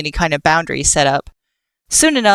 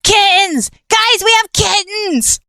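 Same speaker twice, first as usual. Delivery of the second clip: much higher, loud calling out; excited screaming; 4 dB louder, slower than usual. Acted. Killer screams to the group that they have kittens, high and loud. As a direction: yell the news across to the others. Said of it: "heard Killer screaming".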